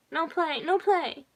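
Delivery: put-on high, loud toddler-like plea; upset little girl, pitch way up; child's cry. A narrator's reading. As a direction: cry out in protest like a small girl. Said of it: "she cried".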